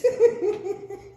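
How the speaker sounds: high-pitched burst of laughter; real high giggle. Real, not acted. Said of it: "you are so whipped".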